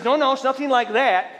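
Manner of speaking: high, loud protest; lady caller. Retold or imitated. Orby on the phone, high and insistent. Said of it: "She says".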